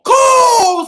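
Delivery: loud, high excited cry; excited shout. Acted. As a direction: shout it out with delight. Excited reading of picture book.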